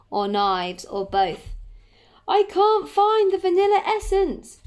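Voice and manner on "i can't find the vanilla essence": high, loud cry of panic; panicked shout. A narrator's reading. Panicked baker shouts across the bookshop, high and loud. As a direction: shout it out high, as if disaster struck. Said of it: "Jack shouted".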